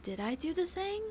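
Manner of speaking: anxious self-doubt. Pretend. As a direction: ask it half-lost and unsure. Imagining psychosis in prison.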